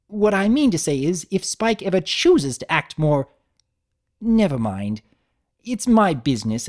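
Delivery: high, loud, frosty refined mare; Rarity voice. Acted. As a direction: play a fashion mare ending the talk.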